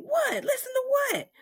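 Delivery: high-pitched, incredulous retort; baffled question. Retold or imitated. Groggy wife questioning her husband, high and baffled. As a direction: ask it high in disbelief.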